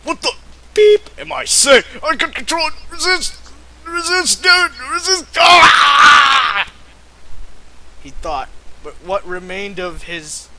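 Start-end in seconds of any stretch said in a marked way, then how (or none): 0.0-5.2 much higher, louder panicked pleading; frantic struggle. Acted. Wolf fights the dark force inside him, hammed-up and frantic. 5.4-6.7 loud high hammed-up cry; anguished scream. Wolf yells as his mind slips away, loud and hammed-up. Wolf loses control, shout.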